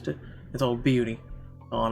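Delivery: ordinary talk, nothing marked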